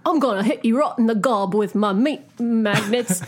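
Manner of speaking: hammed-up British slang threat; mock British accent. Podcaster riffing on Nick's slang, mock British accent. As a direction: riff like a comic British tough. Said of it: "in England".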